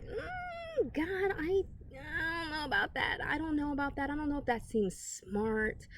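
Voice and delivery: high, whiny, near-tearful hedging; hesitant doubt. Retold or imitated. Believer hesitating to trust God, high whiny reluctant voice. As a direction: balk at God's call with a wavering whimper. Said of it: "And we hesitate".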